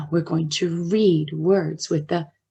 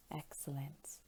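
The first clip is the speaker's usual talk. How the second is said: soft whisper; whispered praise; 5 semitones lower, 22 dB quieter than usual. Shown as a demonstration. Teacher whispers praise to children. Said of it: "teacher whispers praise".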